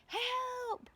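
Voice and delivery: high panicked cry for help. Acted. Centipede crying out in terror as the peach plunges. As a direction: shriek it as the peach falls. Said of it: "cried the centipede".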